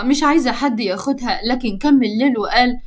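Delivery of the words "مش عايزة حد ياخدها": usual speaking voice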